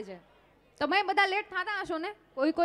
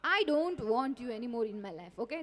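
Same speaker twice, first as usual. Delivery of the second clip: very slow, lower, drawn-out dismissal; dramatic slow breakup; 9 semitones lower, 5 dB quieter, much slower than usual. Acted. Girlfriend dumping boyfriend, very slow and low. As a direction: drag every word out to end it.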